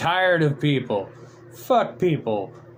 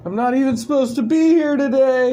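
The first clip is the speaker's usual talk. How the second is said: loud, high exasperated quote; mock outburst; 10 semitones higher, 6 dB louder than usual. Streamer quoting a classic line to chat, loud exasperated outburst. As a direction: blurt the movie line out, higher and louder.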